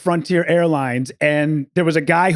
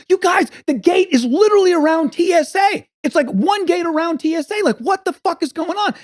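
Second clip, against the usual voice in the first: high, exasperated retold rant; losing it; 11 semitones higher than usual. Traveler arguing at the ticket counter, retold losing it. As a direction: rant in hammed-up exasperation, pitch way up. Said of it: "I'm losing my shit".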